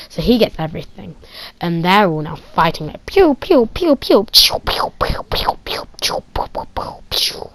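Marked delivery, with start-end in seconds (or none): 3.1-7.5 loud zaps fading to whispers; pew-pew trailing into breathy puffs; fading blaster noises. Retold or imitated. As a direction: let the laser sounds die away. Kid imitating blaster fire.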